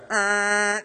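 high, held buzzer noise; imitated goal horn. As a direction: mimic a hockey horn on one note.

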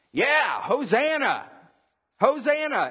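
high, slow, drawn-out cheer; imitated excited crowd. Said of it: "excited 'cause people around them are excited".

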